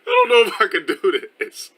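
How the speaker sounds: high, loud, breaking into real laughter; helpless laughter in the words; cracking up. Real, not acted. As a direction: lose it laughing, unable to carry on. Cracks up mid-apology reading.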